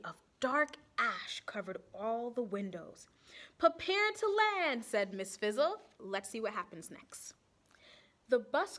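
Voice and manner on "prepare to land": much higher and louder order; thrilled teacher command; excited command. Acted. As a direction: call out the landing.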